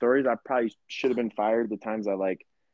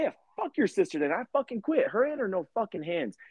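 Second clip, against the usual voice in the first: high, heated mock defiance; retold angry quitting; 9 semitones higher than usual. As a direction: double down and storm out. Quitting in a rage.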